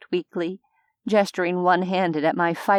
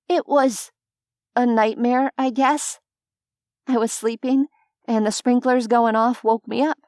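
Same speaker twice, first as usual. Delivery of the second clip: put-on higher, hesitant young woman lying; fumbling an excuse, a touch louder; nervous lie; 5 semitones higher, 4 dB louder than usual. A narrator's reading. Heroine lies about fire.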